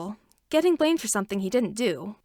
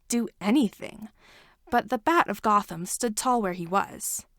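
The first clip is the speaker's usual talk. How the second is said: usual speaking voice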